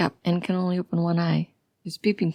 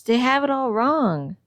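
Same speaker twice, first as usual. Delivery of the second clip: higher, insistent protest; 5 semitones higher, 4 dB louder than usual. A narrator's reading. Patient to nurse about the cops, protesting. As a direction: insist on it, pitch climbing. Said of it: "it protests".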